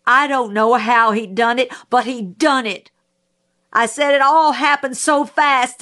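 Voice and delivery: loud, high raging outburst. Acted. Mayella raging at Atticus on the stand, loud and high. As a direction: spit it out at the lawyer, furious. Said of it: "Mayella was raging".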